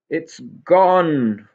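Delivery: high, loud furious outburst; raging cry. A narrator's reading. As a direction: explode in rage at the lost boat. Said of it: "raged Mister Hey There".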